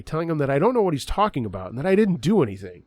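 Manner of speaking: plain reading voice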